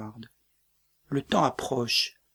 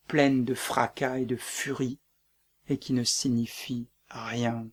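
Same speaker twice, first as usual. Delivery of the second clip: ordinary delivery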